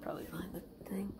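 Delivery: fast whisper. Real, not acted. Owner whispering quickly while looking for the hiding pet.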